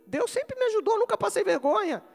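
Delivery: high, ringing exhortation; full-force preaching. Real, not acted. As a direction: preach it out with conviction.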